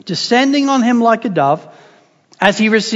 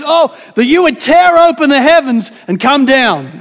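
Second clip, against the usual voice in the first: fervent prayer; 5 semitones higher, 6 dB louder than usual. Acted. Isaiah's prayer, quoted with force.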